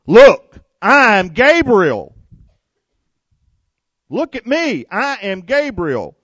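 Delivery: loud, high, indignant "Look, I am Gabriel"; the offended angel puts Zechariah in his place; angry angel. Acted. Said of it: "This angel was not happy".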